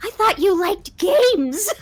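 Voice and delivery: high, slow, taunting ghost; sing-song menace from a ghost; a high creepy spirit toying with her; shrieking ghost voice. Acted. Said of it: "A shrieking, piercing voice".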